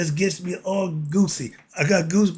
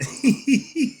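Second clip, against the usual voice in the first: laugh; 9 semitones higher than usual. Real, not acted. Laughs at own remark.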